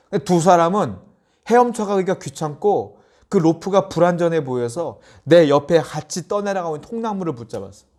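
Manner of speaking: ordinary talk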